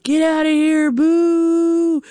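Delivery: boo them out of the store; hostile jeering. Retold or imitated. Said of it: "the cash register was like".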